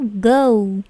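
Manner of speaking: "go" loud and drawn out as "goh-oo"; stretched diphthong on "go"; English OU glide. Shown as a demonstration. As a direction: say "go" emphatically, gliding to "oo". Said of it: "bukan go".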